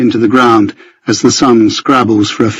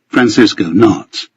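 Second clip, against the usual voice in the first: ordinary delivery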